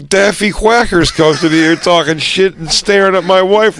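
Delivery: mock drunken rant. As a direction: imitate an aggrieved husband ranting. Imitating drunk mentor ranting about Daffy.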